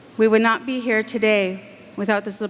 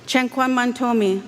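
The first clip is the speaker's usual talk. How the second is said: normal talking voice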